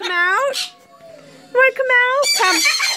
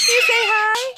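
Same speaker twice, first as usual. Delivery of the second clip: higher, rising sing-song question; coaxing pet talk; 5 semitones higher than usual. Real, not acted. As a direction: coax the bird to say hi.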